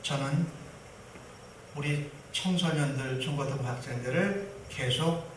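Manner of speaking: the son prays quietly, pleading to keep teaching; earnest quiet prayer. Retold or imitated. Young man praying softly and earnestly. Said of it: "라고 기도했어요".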